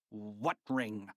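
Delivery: a short, curt snap; mildly snapped question. A narrator's reading. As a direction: bite the question off. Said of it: "snapped Mister Krupp".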